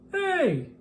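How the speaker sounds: sharp high yell of protest. A narrator's reading. Camper yelling at George over his fire. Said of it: "yelled a camper".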